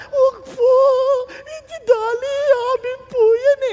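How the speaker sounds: high, tearful, pleading cry; high, teary plea for the pet lamb. Retold or imitated. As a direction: beg through tears to keep the lamb. Poor man pleading for his lamb.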